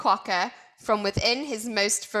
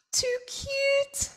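very high giggly spider voice; giggling Redback; 3 dB quieter than usual. A narrator's reading. Redback teasing Quokka, high giggle. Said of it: "giggled Redback".

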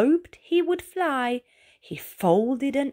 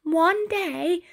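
high, eager snail voice; Ray announcing; 9 semitones higher than usual. A narrator's reading. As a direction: boast it up high like a dreamy little snail. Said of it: "he announced".

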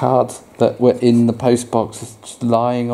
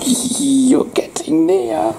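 high and slow, teasing; lightly voiced teasing frog; slower than usual. Parent as Froggy teasing Duck, high and slow. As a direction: draw it out to tease.

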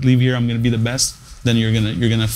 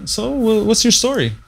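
lightly voiced young woman, pitched higher; a hint of a curious girl at the table, higher; voicing the girl; 9 semitones higher than usual. Waiter retells the diner asking his story, mildly higher and curious. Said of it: "And then she goes".

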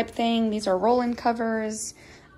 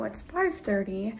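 usual speaking voice

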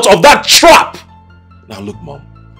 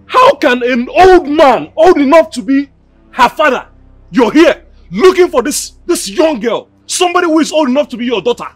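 put-on raised, indignant rebuke of an old man; angry scolding; 6 semitones higher, 3 dB louder than usual. Angry man scolding an older rival over his girl. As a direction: shame him loudly for chasing a young girl.

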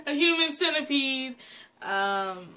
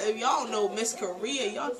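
normal talking voice

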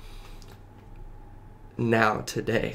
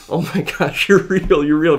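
high, loud, overwhelmed outburst; freaking out; 7 semitones higher, 10 dB louder than usual. Retold or imitated. Retelling the night of conversion to Jesus, freaking out. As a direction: relive it, voice jumping up in amazed shock. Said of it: "freaking out, crying on my bed".

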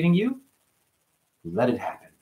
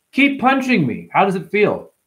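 high-pitched, goading comeback; demonstrated taunt to a bully; mock taunt; 7 semitones higher than usual. Shown as a demonstration. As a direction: goad an attacker, pitched well up. Demonstrates taunting reply to troll.

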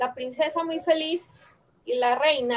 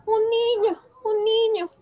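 joyful shout; 9 semitones higher than usual. Acted. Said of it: "El rey grita".

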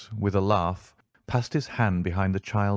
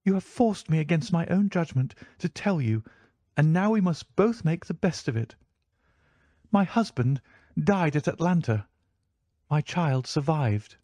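proud wife; 8 semitones higher than usual. A narrator's reading. The lady tells her story.

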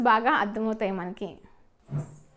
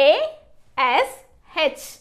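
very slow, letter by letter; spelling out; 2 semitones higher, 4 dB louder, much slower than usual. Shown as a demonstration. Teacher spelling a word for learners, slowly letter by letter. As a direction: spell it with long gaps between letters. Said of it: "ఏమర్థమవుతుంది మనకి".

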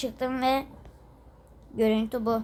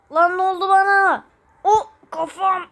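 much higher, louder panicked question; alarmed cry; 6 semitones higher, 6 dB louder than usual. Acted. Character panicking at own change, alarmed cry. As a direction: shriek what happened to you.

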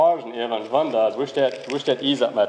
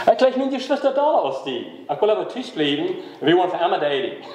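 put-on much higher, caricatured young man making excuses; preacher voicing a young man who wants to date forever, much higher and mocking; mock boyfriend; 4 semitones higher than usual. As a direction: ham up the evasive boyfriend. Boyfriend voiced in story.

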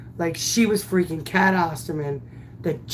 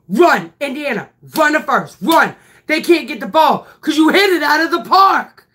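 urging yell; 7 semitones higher, 6 dB louder, faster than usual. Retold or imitated. Coach urging runner around bases.

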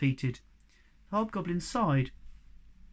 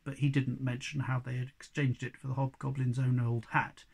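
plain reading voice

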